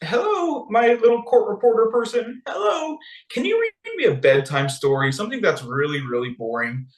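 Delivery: put-on high, babyish plea for a story; whiny child-king. Sleepless king whining to his court reporter. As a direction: ask like a sleepy little boy. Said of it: "he says".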